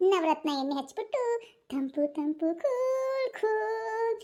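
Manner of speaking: slow, drawn-out advert voice; oil ad jingle. Pretend. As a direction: stretch out every word of ತಂಪು ತಂಪು cool cool like an ad. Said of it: "cool cool ಅಂತ".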